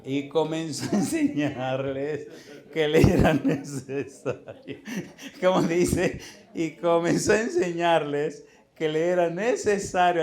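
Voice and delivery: preacher reading Mark aloud with force; emphatic scripture reading. Acted. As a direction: read each phrase slowly like a sermon.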